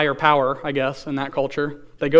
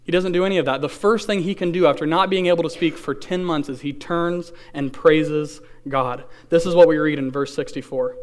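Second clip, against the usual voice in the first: usual speaking voice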